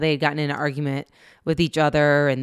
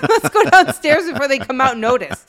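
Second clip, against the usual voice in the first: laughing conspiratorially; 8 semitones higher, 8 dB louder than usual. Retold or imitated. Kids sneaking off, laughing.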